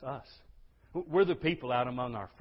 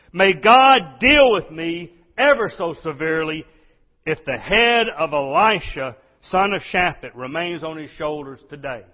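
furious royal oath; 4 semitones higher, 15 dB louder than usual. Acted. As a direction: roar the curse like an enraged king. King vows to kill Elisha.

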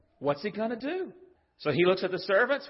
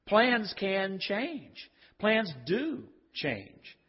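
slow emphasis; 3 semitones higher, much slower than usual. Real, not acted. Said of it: "slow emphatic preaching".

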